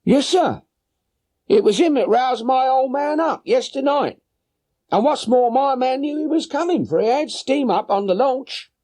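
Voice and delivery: high voiced woman, a little anxious; worried boatman's wife. Boatman's wife telling Holmes her husband is gone, high anxious voice. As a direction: answer the gentleman with a high, fretful woman's voice.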